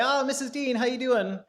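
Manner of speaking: high-pitched, hailing hello; friendly passerby greeting. Retold or imitated. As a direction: imitate an acquaintance calling out in greeting. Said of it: "somebody would say".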